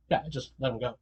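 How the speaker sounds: muttered indifference; low offhand Muzan. Retold or imitated. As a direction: Muzan not caring, low and soft. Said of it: "It's like".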